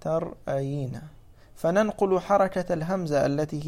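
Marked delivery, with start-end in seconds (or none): 0.0-1.0 "ترأين" slowly, split as tar-'ayiina; underlying form shown. Shown as a demonstration. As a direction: sound out "ترأين" with its hamza, slow and lower-key. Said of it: "أصله ترأين".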